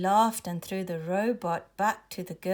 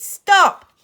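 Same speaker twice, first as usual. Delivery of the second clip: high, loud single burst; shouted order; 11 semitones higher, 13 dB louder than usual. A narrator's reading. Uncle chasing the bullies, shouted order. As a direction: shout a sharp order to halt. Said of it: "he shouted".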